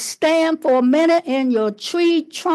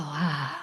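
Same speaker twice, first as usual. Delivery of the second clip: low, very soft sigh; dramatic sigh; 10 semitones lower, 11 dB quieter than usual. Shown as a demonstration. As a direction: fade out on a sigh. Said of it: "open the mouth and just go".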